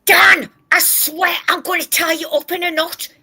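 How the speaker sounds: high, loud, fed-up threat; exasperated scolding. Real, not acted. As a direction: snap at him in frustration. Scolding a co-host who interrupts.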